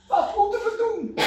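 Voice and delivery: high, loud pleading question; anxious plea. Acted. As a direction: ask it like a frightened crowd. Crowd asking John the Baptist.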